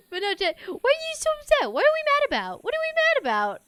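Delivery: loud, high-pitched exaggerated questioning; over-the-top high pet voice; mock scolding dog talk. Shown as a demonstration. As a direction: squeal it at the dog to distract it. Demonstrates how she talks to dog.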